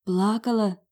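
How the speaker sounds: ordinary delivery, nothing marked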